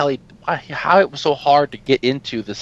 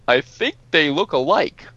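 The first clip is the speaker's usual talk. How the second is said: mock slow kid voice; 3 semitones higher than usual. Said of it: "You'd have to sit there and go".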